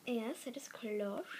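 soft whispered confirmation; whispering. Real, not acted. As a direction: breathe it out quietly.

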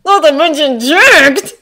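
high, loud, drawn-out admiration; excited boy's admiring exclamation; impressed gushing. A narrator's reading. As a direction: stretch it out, loudly impressed. Ned gushing about Peter's dad.

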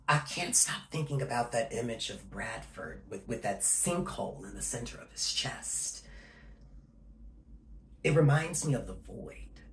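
low and very soft; musing quietly, almost to himself; subdued confiding. Acted. Confiding an image they can't stop thinking about, subdued.

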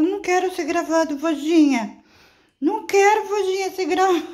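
high, loud sulky dog voice; voicing the grumpy dog. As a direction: whine as the dog who hates the camera. (voz aguda do cachorro emburrado reclamando)

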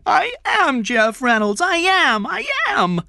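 put-on very high, loud child's wail; rising frantic cries, pitch climbing; crying out. A narrator's reading. Jeff rushes into Kathy's arms, crying out.

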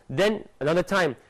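usual speaking voice

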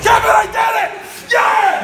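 screaming with joy, high and loud; triumphant yell. Real, not acted. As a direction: yell it out after the win. Game win celebration.

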